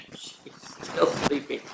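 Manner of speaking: giggly hushed whisper; laughing whisper. Acted. Sleeping child in mock race.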